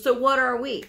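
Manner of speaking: indignant demand. Retold or imitated. Partner to the provider, indignant demand. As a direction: demand an answer, offended.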